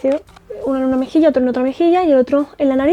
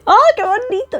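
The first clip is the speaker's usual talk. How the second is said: delighted surprise; 9 semitones higher than usual. Real, not acted. Delighted at lipstick colour.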